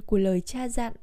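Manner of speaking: ordinary delivery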